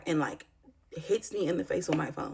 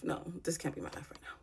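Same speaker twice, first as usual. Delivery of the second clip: much softer, voiceless whisper; despairing whisper; 10 dB quieter than usual. Retold or imitated. Mother recalling her despair over her life, whispered. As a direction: drop to a whisper, refusing to accept it. Despairing words retold from that night.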